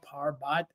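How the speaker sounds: Parbat said two ways, "baht" then "bat"; Parbat, unsure between open and short "a"; alternate pronunciations. Shown as a demonstration. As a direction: try Parbat with both endings. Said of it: "not sure exactly how to pronounce it".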